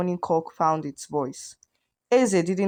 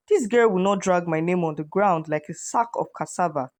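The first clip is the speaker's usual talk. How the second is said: plain reading voice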